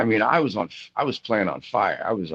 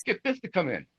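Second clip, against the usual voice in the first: much higher mock sarcastic voice; Ronnie quoted; 11 semitones higher than usual. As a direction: lampoon the boss sarcastically. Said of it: "he goes".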